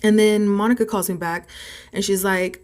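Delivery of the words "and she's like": ordinary delivery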